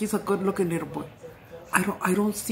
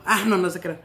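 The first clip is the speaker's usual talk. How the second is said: ordinary delivery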